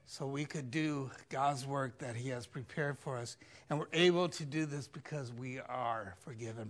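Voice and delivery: ordinary talk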